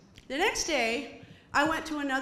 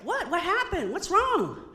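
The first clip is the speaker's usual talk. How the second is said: alarmed questions; 5 semitones higher, 3 dB louder than usual. Retold or imitated. Doug alarmed.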